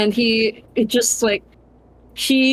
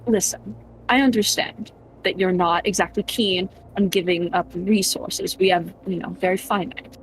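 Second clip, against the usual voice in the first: soothing reassurance; 3 semitones lower, 4 dB quieter than usual. Acted. Prince soothing the frightened boy, soft and calm. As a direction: gently talk the scared kid down. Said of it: "he's just like".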